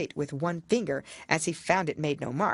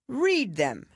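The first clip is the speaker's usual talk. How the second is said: higher, louder royal pronouncement; pompous king; 9 semitones higher, 3 dB louder than usual. A narrator's reading. King explaining the handwriting to the jury, pompous. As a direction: declare it like a self-important king.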